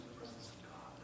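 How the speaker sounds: fast, soft whisper. Real, not acted. Preacher whispering about the presence of God. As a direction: whisper it like a secret. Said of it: "whispering in each other's ear".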